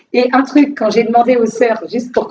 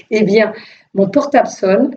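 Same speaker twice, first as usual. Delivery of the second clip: normal talking voice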